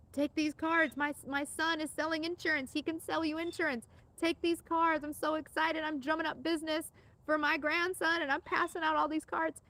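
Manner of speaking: a lightly voiced proud granny pushing cards; proud grandma. Grandma hands out business cards.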